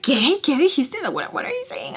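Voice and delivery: high, startled questioning; imitated alarmed disbelief. Caller hearing she is running away, high startled questions.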